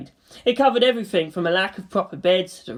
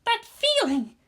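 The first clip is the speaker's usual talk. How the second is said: pitched up hesitant murmur; high mumbling girl; slower than usual. A narrator's reading. Asuka hesitant.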